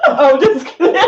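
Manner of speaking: giggling through the words; laughing it off. Real, not acted. Teasing, laughing after insult.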